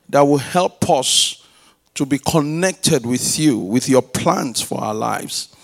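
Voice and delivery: steady, earnest prayer read; opening prayer. Acted. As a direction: pray it over the word with conviction. Opening prayer before the sermon.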